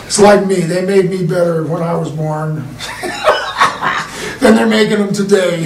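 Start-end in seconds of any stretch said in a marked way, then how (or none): 2.6-4.3 laughing. Real, not acted. Laughing at own joke.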